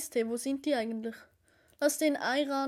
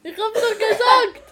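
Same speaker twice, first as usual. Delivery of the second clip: laughing; 6 semitones higher, 9 dB louder than usual. Real, not acted. Laughs at friend's spicy reaction.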